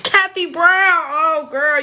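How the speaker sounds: high, delighted call to a friend; light up as she greets her; excited greeting. Real, not acted. Greeting her friend Kathy Brown, high and delighted.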